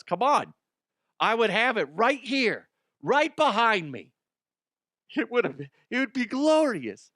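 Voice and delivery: high-pitched comic griping; pleading exasperation. Real, not acted. Host begging for the plaque he was never offered, exasperated. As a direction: plead for it like you were robbed.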